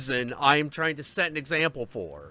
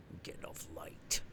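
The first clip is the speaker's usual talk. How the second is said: much softer, a low breathy hiss; whispered threat; 10 semitones lower, 15 dB quieter than usual. Retold or imitated. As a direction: whisper it as a menace.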